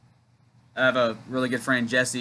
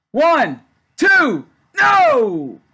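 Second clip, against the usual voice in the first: excited count; 10 dB louder, much slower than usual. Real, not acted. Live pin count cheering.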